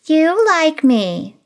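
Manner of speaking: high, slow, teasing you like me; sly twist. Acted. Stephanie teasingly flips Greg's request back, high and slow. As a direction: turn his words back on him.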